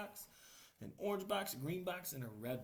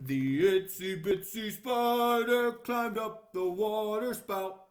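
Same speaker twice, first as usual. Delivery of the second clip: put-on slow, loud read-aloud for children; storybook reading; 5 semitones higher, 12 dB louder, slower than usual. A narrator's reading. Reading the book page aloud to children, slow and loud. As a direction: read it out big and slow for little listeners.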